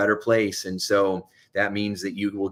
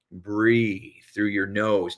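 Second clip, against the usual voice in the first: slow demo; much slower than usual. Shown as a demonstration.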